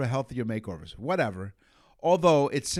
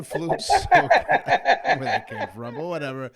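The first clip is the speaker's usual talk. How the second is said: laughing through the words, pitch up; giggling at his own slip; 5 semitones higher, 9 dB louder than usual. Real, not acted.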